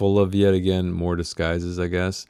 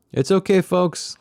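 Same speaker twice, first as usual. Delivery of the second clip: higher call from under the table; 10 semitones higher than usual. Acted. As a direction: call it up to the room above. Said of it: "called Joaquin".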